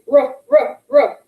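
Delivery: "Ruh" repeated with a strong r; r blend sound. Shown as a demonstration. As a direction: drill the r sound. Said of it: "the ones that say".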